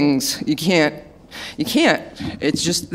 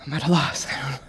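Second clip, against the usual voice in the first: emotional; 4 semitones lower, 7 dB quieter, faster than usual. Real, not acted. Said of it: "I get very emotional here".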